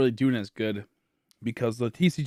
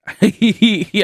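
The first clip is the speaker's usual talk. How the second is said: embarrassed laugh; 9 semitones higher, 8 dB louder than usual. Real, not acted. Laughing at own pronunciation.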